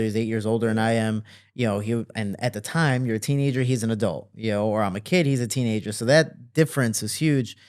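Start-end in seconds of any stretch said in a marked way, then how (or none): none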